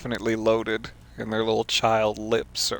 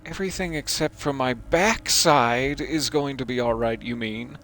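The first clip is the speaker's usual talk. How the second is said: usual speaking voice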